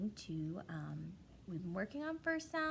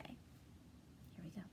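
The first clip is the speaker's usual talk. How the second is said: hushed whispered cue; 4 semitones lower, 17 dB quieter, much slower than usual. Acted. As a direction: whisper it to build suspense. Teacher starts the word game.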